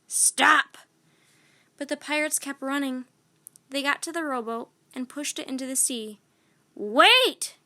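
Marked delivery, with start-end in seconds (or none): 0.1-0.8 shout. A narrator's reading. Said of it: "ran after his men". 6.8-7.6 loud high desperate yell; captain cries out to his fleeing men; cried. A narrator's reading. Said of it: "cried Captain Bones".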